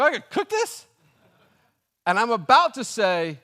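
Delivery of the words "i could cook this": very high, loud comic squawk; mock-outraged disbelief; incredulous. Customer learning he must cook it, hammed-up incredulity. Customer appalled at cold pizza.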